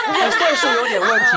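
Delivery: loud, high, laughing jab. Real, not acted. Teasing the friend who muddled her age, loud laugh. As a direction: laugh out the joke about age.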